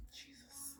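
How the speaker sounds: barely audible, voiceless whisper; overwhelmed whisper, almost no sound; under the breath. Real, not acted. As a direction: breathe it out to yourself. Struggling to say hard news.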